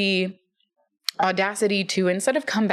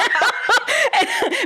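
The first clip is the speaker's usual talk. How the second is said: high, real laughing fit; amused laugh; 3 dB louder than usual. Real, not acted. Laughing at big sister's offer to fight, amused cackle. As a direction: crack up in high-pitched laughter.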